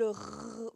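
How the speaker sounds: "r" as a sustained French throat sound; isolated phoneme. Shown as a demonstration. Teacher showing where it resonates, "r" held in the throat. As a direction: hold "r" resonating in the throat, not the letter name. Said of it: "montrer le lieu où ça résonne".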